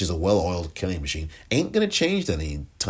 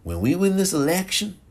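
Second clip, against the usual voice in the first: failed impression, higher and rising; badly faked president, pitched up; Ronald Reagan; 10 semitones higher than usual. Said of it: "That's not even how Reagan sounds".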